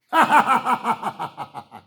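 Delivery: short mocking laugh; scornful laugh. Real, not acted. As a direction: laugh it off in disbelief. Laughs at the excuse.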